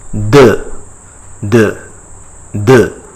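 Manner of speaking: "de" as duh, close to English "the" with a d; French pronunciation. Shown as a demonstration. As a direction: repeat "de" as separate short duh sounds. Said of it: "almost similar to T H E".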